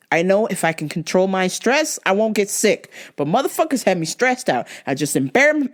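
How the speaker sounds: normal talking voice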